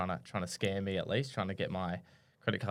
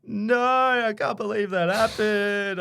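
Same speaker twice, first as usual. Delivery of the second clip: high, loud, slow imitation of an upset woman; imitating the upset caller; 12 semitones higher, 8 dB louder, much slower than usual. Retelling the wrong-number caller's reaction, high and slow. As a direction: mimic her as a high, drawn-out wail of disbelief. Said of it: "She was spewing".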